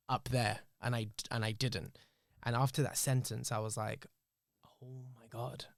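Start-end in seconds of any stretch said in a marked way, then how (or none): 4.8-5.6 very soft, retold awe; stunned hush. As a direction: barely voice it, as the realization sinks in. Retelling reaction to therapist's key sentence.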